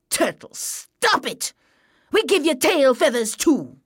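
lightly voiced bossy bird; scolding bird. A narrator's reading. Bird scolds turtle in told story.

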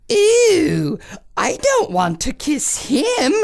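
high whiny disgusted wail. Acted. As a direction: whine it in disgust. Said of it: "wailed the lady-in-waiting".

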